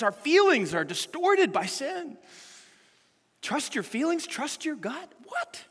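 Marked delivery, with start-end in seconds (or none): 3.4-5.1 skeptical rising echo of the advice, softer; incredulous question. Shown as a demonstration. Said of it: "The Bible says the exact opposite". 5.3-5.6 mock disbelief. Mocking disbelief at bad advice.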